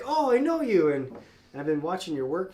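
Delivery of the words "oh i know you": retold delighted recognition, much higher and louder; bright recognition of a familiar face, imitated; retelling a store owner's happy surprise; recognizing someone. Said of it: "and it was like".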